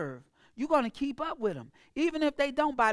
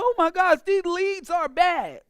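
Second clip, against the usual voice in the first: whiny complaining; 6 semitones higher, 8 dB louder than usual. Retold or imitated. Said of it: "you'll be like".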